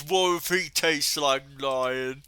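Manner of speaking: oafish brute voice, hungry; big oaf. Acted. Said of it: "as the big oaf".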